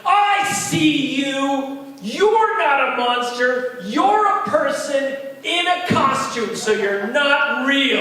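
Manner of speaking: put-on loud, high, slow furious child; angry kid. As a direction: shout it like a defiant little boy. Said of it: "and angry".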